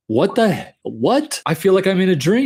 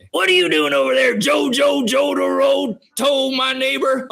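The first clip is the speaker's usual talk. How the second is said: anime dub voice; 9 semitones higher than usual. Retold or imitated. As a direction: overact it like a bad English dub. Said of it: "English dub anime".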